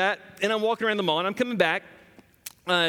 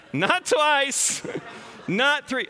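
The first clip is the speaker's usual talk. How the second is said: laughing; 8 semitones higher, much slower than usual. Real, not acted. Recalling daughter's vomiting, laughing.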